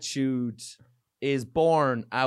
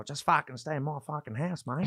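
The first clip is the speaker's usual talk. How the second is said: higher, broad mock Aussie; Australian accent; 4 semitones higher than usual. Comic imitating Perth host inviting them to stay, Australian accent. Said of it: "I'm doing this Australian accent".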